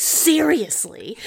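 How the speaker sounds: high, loud and slow mock exasperation; imitating an irritated dad; exasperated dad. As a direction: drag it out like a fed-up father. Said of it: "He was like".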